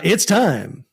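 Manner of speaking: slow, grand announcer build-up; game show announcer. Acted. Announces trivia game segment.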